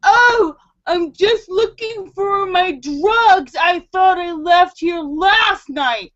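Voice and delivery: high, drawn-out mock excuse; imitated intruder. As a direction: imitate a guy sheepishly explaining himself, slowly. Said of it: "He's, he actually says".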